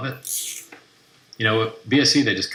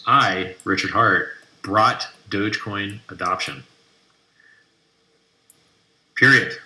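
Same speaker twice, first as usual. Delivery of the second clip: mocking pompous read; 5 dB louder, slower than usual. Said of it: "Let me read to you this garbage quote".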